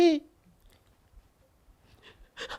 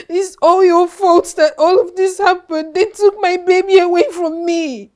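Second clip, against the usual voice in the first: ordinary talk, nothing marked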